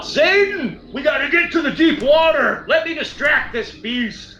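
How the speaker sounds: high, urgent battle shout; in-character yell. Acted. Warrior to his ally as he plans to distract the beast, yelling. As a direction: call out across the battlefield to an ally. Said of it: "I yell out".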